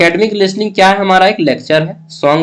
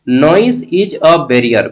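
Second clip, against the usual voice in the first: slow reading; much slower than usual. Shown as a demonstration. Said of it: "reads question word by word".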